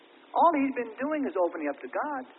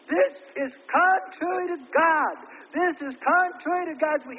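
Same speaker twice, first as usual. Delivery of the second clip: high, loud and slow protest; quoted vehement protest; 8 semitones higher, 6 dB louder than usual. Retold or imitated. Preacher voicing the brother's protest, slow, high and loud. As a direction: drag it out like a man outraged.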